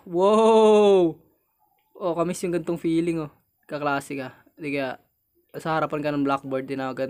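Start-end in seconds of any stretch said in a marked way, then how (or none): 0.1-1.2 loud, long, raised wow; startled wow. Real, not acted. Startled mid-sentence in game. (gulat na bulalas)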